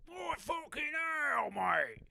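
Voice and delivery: hammed-up Peaky Blinders British accent. Shown as a demonstration. Comic auditioning for Peaky Blinders, exaggerated British accent. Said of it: "I can do a great British accent".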